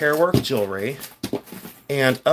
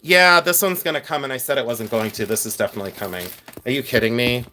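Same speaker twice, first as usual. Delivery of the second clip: ordinary talk, nothing marked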